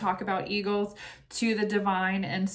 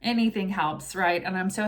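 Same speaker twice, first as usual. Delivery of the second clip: ordinary talk, nothing marked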